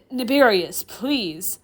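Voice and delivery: loud, falling plea; futile pleading. Acted. Chair demon futilely calming the professor, pleading. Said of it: "Sullivan futilely pleads".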